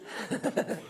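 quick, quiet chuckle; a brief, soft laugh to oneself; short chuckle. Real, not acted. Laughs after remarking people sigh.

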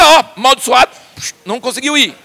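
ordinary delivery

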